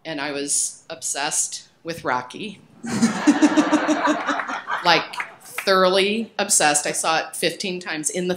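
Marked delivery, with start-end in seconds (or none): 2.8-4.8 high, bursting embarrassed laugh; a big embarrassed laugh, louder; burst of laughter. Real, not acted. Embarrassed about teen obsession.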